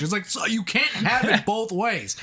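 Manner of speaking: high-pitched, incredulous complaint; exasperated rant. Real, not acted. Podcaster complains about a robot's hypocrisy, exasperated.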